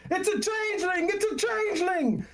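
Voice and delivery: high, panicked yelling; crowd screaming. Acted. Market crowd screaming at the changeling, high and panicked. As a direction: scream the accusation so everyone hears. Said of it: "the crowd starts to, to scream".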